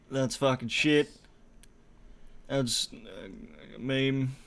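ordinary delivery

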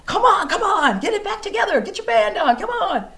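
rally her back; cheering friends. Retold or imitated. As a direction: friends urging her, high and loud. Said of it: "And they go".

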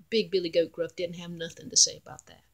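ordinary delivery, nothing marked

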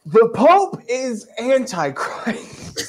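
high, slow, hammed-up cry about the Pope; mock Cranmer shouting it out with glee; mock defiant proclamation. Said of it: "he's like".

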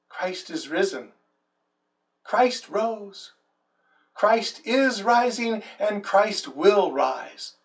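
usual speaking voice

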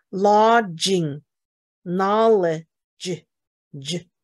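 very slow "lodging", "knowledge", then "J"; same J sound demo. Shown as a demonstration. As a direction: stretch "lodging" and "knowledge" to show the shared j. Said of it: "它们都是发同一个音".

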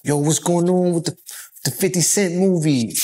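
quick New York twang on the question; New York accent. Retold or imitated. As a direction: ask it like a New Yorker. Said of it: "I had to use my New York accent".

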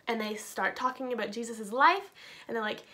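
ordinary talk

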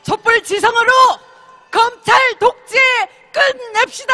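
rally chant, shouted high; roaring rallying speech. Real, not acted. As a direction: lead the crowd in a fiery chant. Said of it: "우리 한번 신나게 외쳐봅시다".

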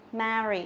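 "marry" with a wide, open æ; corrected model "marry" with flat æ; flat E sound. Shown as a demonstration. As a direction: open the first vowel of "marry" as in "cat". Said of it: "nó cũng là âm E bẹt".

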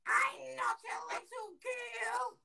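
put-on indignant, very high squeaky toddler; baby voice. Prank child correcting the bloke, indignant squeaky baby voice. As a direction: drag it out in a piercing tiny squeal. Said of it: "The, the baby voice".

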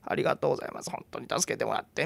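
thanks that can hardly get out; barely voiced thanks. Shaken mother thanks the old woman, barely audible. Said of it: "声にならない言葉".

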